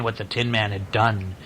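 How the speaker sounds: ordinary delivery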